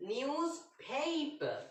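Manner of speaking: নিউজ পেপার said slowly, each syllable clear; slow model pronunciation. Shown as a demonstration. Teacher slowly modelling নিউজ পেপার for students. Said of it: "এটি হলো তার সঠিক উচ্চারণ".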